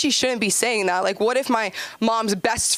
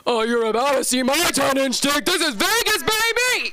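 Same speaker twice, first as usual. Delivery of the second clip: brash comeback; 6 semitones higher than usual. Retold or imitated. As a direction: belt it out like a Vegas show. Her comeback, acted big.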